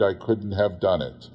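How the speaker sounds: plain reading voice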